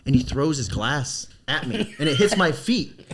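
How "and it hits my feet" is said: ordinary talk, nothing marked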